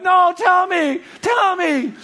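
very high, loud pleading shout; desperate demand. Retold or imitated. Student on the edge of his seat to teacher, desperate demand. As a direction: shout it, frantic for the answer. Acts out begging teacher for the secret.

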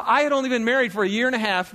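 ordinary talk, nothing marked

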